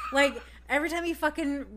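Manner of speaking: usual speaking voice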